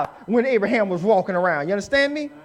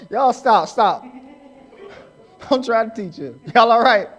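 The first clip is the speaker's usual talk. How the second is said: ordinary delivery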